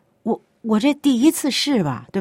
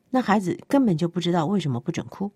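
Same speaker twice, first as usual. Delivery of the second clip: usual speaking voice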